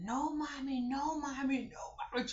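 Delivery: put-on high pleading child voice, repeated; high pleading no mommy. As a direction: beg like a scared little girl. Daughter pleading on phone.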